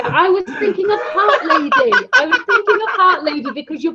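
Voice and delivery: laughing apology. Real, not acted. Host apologising to a viewer for a mix-up, laughing. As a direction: apologise through giggles.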